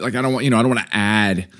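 ordinary talk

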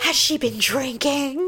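slow, prying stranger voice; a hint of a gossiping bystander; judgy onlooker. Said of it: "they're like".

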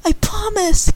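high, earnest pledge; a girl promising the raccoon she'll keep Rocky, tearful; tearful promise. A narrator's reading. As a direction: promise through mild tears. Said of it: "Candy cried".

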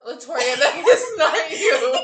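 loud, slow, through laughter; laughing. Real, not acted. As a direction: laugh it out in disbelief. Laughing at the drawing.

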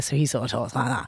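low imitated Manchester dad; Manchester accent. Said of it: "my dad's from Manchester".

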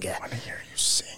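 breathy whispered mock demand; creepy whisper. Echoing the creepy demand to the kids, in a whisper.

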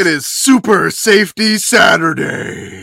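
slow booming announcer with echo; canyon echo. Shown as a demonstration. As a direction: call it out like it echoes off canyon walls. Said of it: "can we get, like, an echo effect on my voice here".

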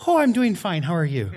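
high, loud Irish lilt; Irish accent. Retold or imitated. Actor stuck in stage dialect answers a greeting, Irish accent. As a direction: chirp it back in a hammy Irish accent. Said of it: "keeping that, that dialect".

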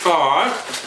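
talking while chewing. Real, not acted. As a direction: mouth full of burger. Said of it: "Chewing in your ear".

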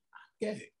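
barely breathed, ashamed whisper. Retold or imitated. Guest recalling the confession, hushed whisper. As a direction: whisper it like a secret. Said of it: "I've never said without embarrassment".

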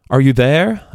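a faint voice calling out. A narrator's reading. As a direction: call across empty distance. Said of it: "a voice called".

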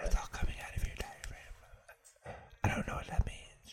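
all air, no throat voice; trying out a soft whisper. Shown as a demonstration. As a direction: whisper it from the diaphragm. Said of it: "there's no voice in your throat".

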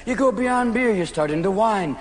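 usual speaking voice

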